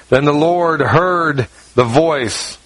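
"Then the Lord heard the voice" slowed, "heard" stressed; preacher stressing that the Lord "heard", slowly; slow emphatic reading. Shown as a demonstration. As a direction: lean hard on "heard", slowly.

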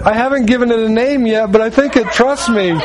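high, plaintive mock wife; acted-out reluctant wife; imitated pleading wife. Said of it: "so she said".

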